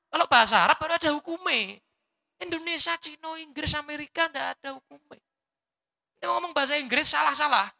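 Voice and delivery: berapi-api, suara tinggi dan keras; fiery preaching. Acted. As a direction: berkhotbah penuh semangat. (ceramah lantang penuh semangat)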